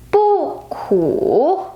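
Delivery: "Bu" falls, then "ku" dips and rises; unchanged fourth tone. Shown as a demonstration. Teacher showing bu stays the same: falling "Bu", dipping "ku". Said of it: "the word bu stays the same tone".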